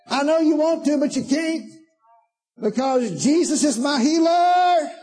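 high, slow, shouted declaration; right way, full-voiced rebuke; loud defiant command. Shown as a demonstration. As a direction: shout it down with authority. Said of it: "with a loud voice".

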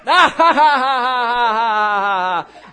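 loud, long put-on guffaw; drowning-out mock laugh. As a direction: cut the caller off with laughter.

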